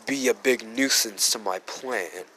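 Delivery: softly muttered retold aside; under his breath. Deku picks up Yuri and speaks under his breath. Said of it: "say this under his breath".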